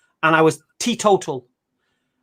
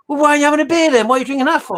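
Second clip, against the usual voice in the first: high, fast incredulous pub geezer; drunk pub bloke; 9 semitones higher, 4 dB louder, faster than usual. Retold or imitated. Pub drinker challenging him, high and fast. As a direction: fire it back at him, baffled and pushy. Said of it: "And he goes".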